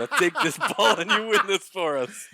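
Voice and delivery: rousing pep talk. Pretend. Pep talk to young Jeter.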